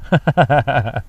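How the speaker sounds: real rapid chuckling; amused laugh. Real, not acted. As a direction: keep laughing at the joke. Laughs at tractor joke.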